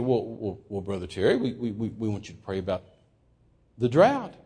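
soft, low, halting reply; timid stammer. Retold or imitated. Cowed preacher answering an angry guest, timid stammer. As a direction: shrink back and answer meekly.

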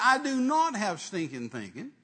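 loud, high, slow denial; retold indignant retort to God; offended protest. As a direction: deny it indignantly, spacing the words out. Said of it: "That just kind of offended me".

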